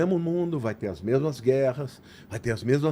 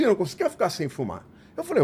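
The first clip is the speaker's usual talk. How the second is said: normal talking voice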